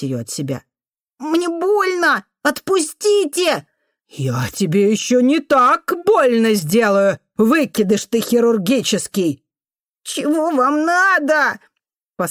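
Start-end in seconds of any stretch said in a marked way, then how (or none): 1.2-3.7 put-on very high, drawn-out child's wail; pained wail. A narrator's reading. Boy in the janitor's grip begging her, wailing. Held boy cries in pain. 4.1-9.4 raised, insulting scolding voice; angry threat. A narrator's reading. Janitor woman answering the boy's pleas, angry. 10.0-11.6 put-on high, slow child's whine; tearful protest. A narrator's reading. Boy with tears running asks the janitor, whining.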